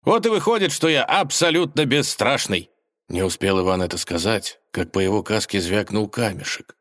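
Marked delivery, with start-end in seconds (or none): 0.0-2.7 Ivan boasting. Acted. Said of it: "хохотнул Иван".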